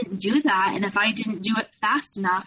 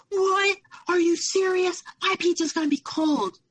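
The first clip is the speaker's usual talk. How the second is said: high, mock-whiny outraged boyfriend; imitating a petulant partner's high whine; freaking out; 10 semitones higher than usual. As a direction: squeal it like a spoiled man-child about cold pizza. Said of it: "just freaking out on me".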